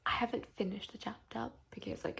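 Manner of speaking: soft late-night whisper; hushed night whisper. Real, not acted. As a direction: keep your voice down. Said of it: "it is nine o'clock".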